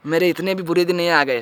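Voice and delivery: usual speaking voice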